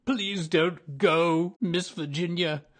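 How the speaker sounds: loud, high, slow plea; crying out. A narrator's reading. Lonely ghost begging Virginia not to go, loud cry. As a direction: beg her to stay, loud and high. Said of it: "he cried".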